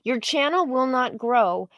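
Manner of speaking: ordinary delivery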